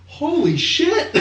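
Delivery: very high, slow, laughing holy shit; amazed. Retold or imitated. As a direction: squeal it out, stunned and laughing. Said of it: "I'm like".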